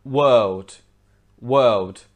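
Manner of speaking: world with long vowel, no R; British silent R. Shown as a demonstration. Teacher giving British version, world without R. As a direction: say world loud and British, R unsounded. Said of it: "in British English would be".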